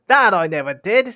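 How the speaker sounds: hot-tempered troll retort; indignant denial. Acted. Tom denies it in quarrel.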